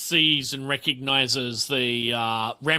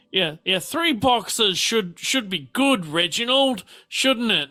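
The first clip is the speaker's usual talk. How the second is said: higher lilting lady's voice; character voice; 6 semitones higher than usual. Acted. Telling Reginald what to give, higher coaxing voice. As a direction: coax her husband in a higher voice. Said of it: "and is like".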